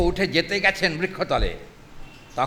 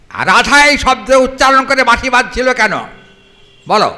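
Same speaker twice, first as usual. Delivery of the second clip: pressing challenge; 8 semitones higher, 13 dB louder than usual. Acted. Lalita presses Krishna.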